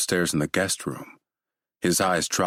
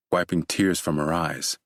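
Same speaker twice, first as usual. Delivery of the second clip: plain reading voice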